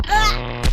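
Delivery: jolted groan from a taser shock; being electrocuted. Pretend. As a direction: grunt like getting zapped. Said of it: "Suspect has been subdued and is twitchy".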